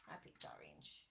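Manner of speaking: breathy whispered aside. Real, not acted. Teacher whispering to her young viewers.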